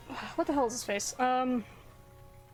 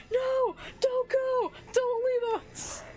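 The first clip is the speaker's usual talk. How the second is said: put-on screaming kid; 12 semitones higher, much slower than usual. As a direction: scream it like a desperate little kid. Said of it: "the kid's just screaming".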